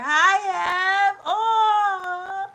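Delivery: loud, high, choked-up hello; welling up with real tears; real tearful greeting. Real, not acted. Speaker greets her big sister online, crying for real. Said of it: "Now I'm gonna cry".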